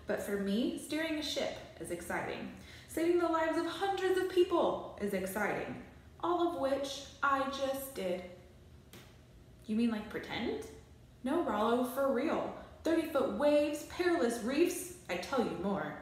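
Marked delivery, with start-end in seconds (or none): none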